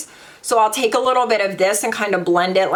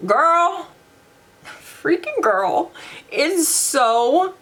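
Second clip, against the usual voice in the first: gushing excitement; 7 semitones higher, much slower than usual. Real, not acted. Gushing to viewers about the glitter shadow.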